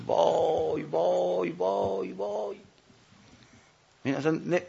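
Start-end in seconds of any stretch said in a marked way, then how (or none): 0.0-2.6 high, soft, drawn-out moan; remorseful wail. Acted. Sinner melting with shame at the memory, a soft rising wail. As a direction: moan it rising and fading away. Imagining shame at remembered sin.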